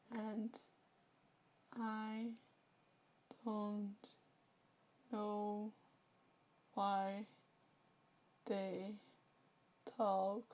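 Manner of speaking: each word dragged out with long pauses; mock slow East Flemish drawl; slow talker demo. Shown as a demonstration. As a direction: talk painfully slowly like a Flemish slow talker. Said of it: "so they talk like this".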